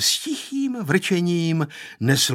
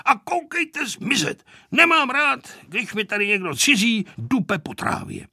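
high-pitched cross grumbling; ranting; 6 semitones higher than usual. A narrator's reading. Grandfather ordering strangers off his grass, ranting. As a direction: keep up the grandfather's fussy rant. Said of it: "povykoval dědeček".

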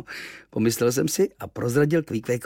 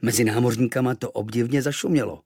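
usual speaking voice

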